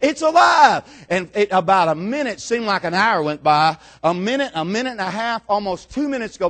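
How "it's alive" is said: high, loud repeated cry; imitated madman hollering to the crowd; screaming street showman. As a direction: shout it out while running in circles. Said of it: "this guy's screaming at the top of his lungs".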